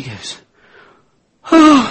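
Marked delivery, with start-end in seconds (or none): 1.5-1.9 high, loud cry of distress; crying wail. Retold or imitated. Wife bursts into tears at husband's gifts, high loud wail. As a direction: let it break out into a wail. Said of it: "she just busts out crying".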